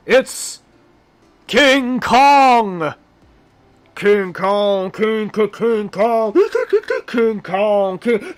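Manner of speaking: booming, drawn-out TV show announcer; Don Pardo announcer voice. Pretend. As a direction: belt it high and slow like a game-show host introducing the star. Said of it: "I'll hit my announcer voice, my Don Pardo".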